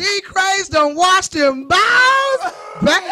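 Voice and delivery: slow, loud drawn-out tease; loud teasing. Real, not acted.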